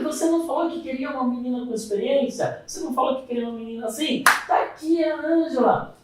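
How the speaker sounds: re-enacted indignant pushback; indignant challenge. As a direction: throw the boss's request back as an argument. Retold argument with the boss.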